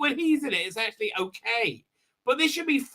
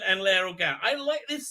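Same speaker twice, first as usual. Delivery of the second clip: ordinary delivery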